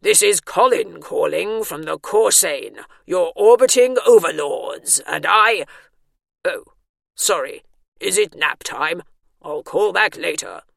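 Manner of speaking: loud alien overlord turning apologetic; alien-sounding voice. A narrator's reading. Alien overlord calls, then apologises for interrupting nap time, alien voice. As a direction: proclaim grandly, then falter into an apology. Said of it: "burst an alien-sounding voice inside her head".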